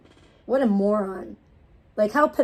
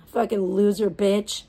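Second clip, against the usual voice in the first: ordinary delivery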